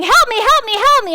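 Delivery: hammed-up child yelling help; mock kid scream. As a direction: call out like a child in a fire. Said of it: "a kid screaming".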